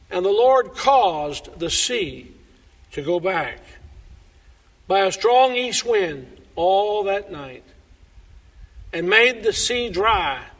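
slow, emphatic Bible reading; scripture reading. Acted. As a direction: read each phrase with solemn weight. Reads verse twenty-one aloud.

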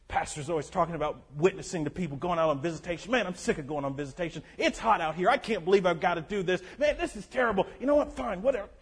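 low, soft, sulky griping under the breath; grumbling churchgoer. Retold or imitated. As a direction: mutter complaints about visitation like a resentful member. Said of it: "we can get there".

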